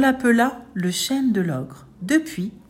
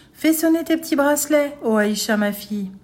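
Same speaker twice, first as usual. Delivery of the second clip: high, clear chant falling at the end; fine, clear ogre voice; 7 semitones higher, 3 dB louder than usual. A narrator's reading. Ogre poses as grandfather to the girl, fine clear voice. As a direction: intone it in a thin disguised voice. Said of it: "de sa voix fine et claire".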